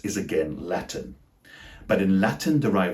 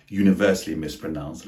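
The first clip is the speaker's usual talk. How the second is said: ordinary talk, nothing marked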